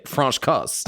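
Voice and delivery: French Kiss said the French way, as if by a Frenchman; French accent. Shown as a demonstration. Host playfully re-saying the film title in a mock French accent. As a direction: give it a jokey French accent. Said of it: "Or should I say".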